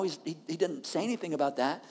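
ordinary talk

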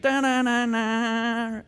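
drawn-out game sound, sung out; imitated game-over jingle. Game tells player they hit out of bounds, imitated jingle. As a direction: mimic a video game's losing tune. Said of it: "what's the game do?".

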